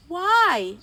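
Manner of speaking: high, loud angry why; hungry friends demanding why, angry and loud. A narrator's reading. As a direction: snap the question back in anger. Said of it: "asked the others angrily".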